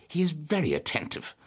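high-pitched, knowing compliment; arch. A narrator's reading. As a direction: lilt it up archly, with sly irony. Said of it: "she said archly".

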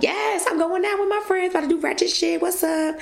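bouncing with going-out excitement; hyped party voice. Retold or imitated. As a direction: sell it like payday just hit. Retelling plans to party.

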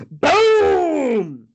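long, loud, high-pitched "boom"; booming impact. Retold or imitated. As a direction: belt out a drawn-out cartoon boom. Vocal impact sound in retelling.

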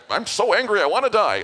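mock Jonah's whining, high and loud; whiny tantrum. Pastor mocks Jonah to church, high whiny tantrum. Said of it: "what is with this guy".

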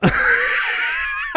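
high unvoiced squeal of laughter. Real, not acted. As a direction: burst into a shrieking laugh. Laughs at space dust remark.

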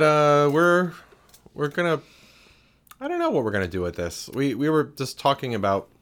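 ordinary delivery

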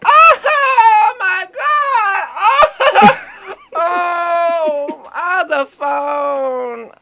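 shrieking high laugh-cry through the words; squealing, out-of-control laughing fit; hysterical laughing. Retold or imitated. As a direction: laugh hysterically, almost crying. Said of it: "hysterically laughing fit".

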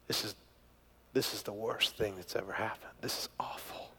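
soft, hushed dismay; despairing whisper. Retold or imitated. Mother finding her rings gone, hushed whisper of despair. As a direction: barely breathe it out in despair. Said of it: "the look on her face communicated".

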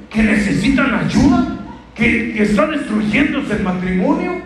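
rising, insistent sermon challenge; preaching at full force. Acted. Preacher urging the congregation to insist, fervent preaching. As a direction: push the congregation not to give up.

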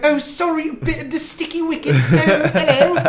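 high, posh mock British accent. Mourner apologizing at the queen's coffin, in a hammy British accent. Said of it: "and then you kiss her on her little dead forehead".

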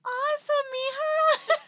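very high-pitched squeal, imitating mom; high, drawn-out mock-mom reaction; imitated mom. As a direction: pitch it way up like a thrilled mother. Voicing mom's reaction on video call.

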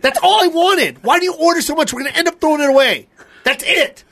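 high, exasperated retold tirade; mad. Retelling his outburst over too much food, mad. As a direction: rail at him, voice climbing. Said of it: "And I'm mad".

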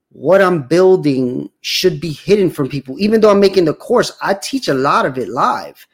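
ordinary delivery

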